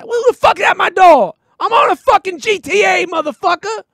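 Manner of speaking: high, loud furious gamer yell; mock gamer rage. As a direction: scream at whoever interrupts. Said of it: "playing video games".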